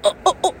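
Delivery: short high "oh" jolts; three quick yelps, as if zapped; imitated shock yelps. Storyteller showing repeated little shocks, high mock yelps. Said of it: "Kept getting shocks".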